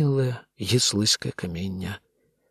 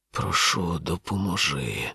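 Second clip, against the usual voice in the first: low, soft, slow groaned plea; благальний стогін; 7 semitones lower, 5 dB quieter, slower than usual. A narrator's reading. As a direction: plead for help in a weak, drawn-out moan. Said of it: "простогнав Мак". (тихий, протяжний стогін із благанням)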